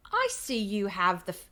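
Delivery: guard-style English accent, cut off. Shown as a demonstration. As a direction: start the line in an English accent. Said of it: "it says he sounds American".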